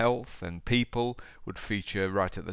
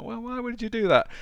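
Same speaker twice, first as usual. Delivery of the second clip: incredulous; 5 dB louder than usual. Retold or imitated. Mimicking himself to wife.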